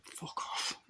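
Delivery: unvoiced mock-annoyed whisper; whispered dismissal. Viewer to the ungrateful daughter, whispered dismissal. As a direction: curse the character under your breath. Muttered aside at film character.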